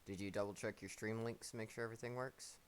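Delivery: ordinary talk, nothing marked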